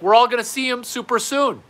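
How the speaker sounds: high, loud proclamation; hammed-up comforting; overblown consolation. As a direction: proclaim it loudly with pitch way up. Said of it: "Who talks like this?".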